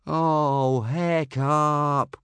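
a mild exasperated moan; groan.

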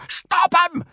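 very high, loud strangled command; furious screech. A narrator's reading. Gobber ordering Hiccup after the runaway dragon, screeching. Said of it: "screeched Gobber in a furious".

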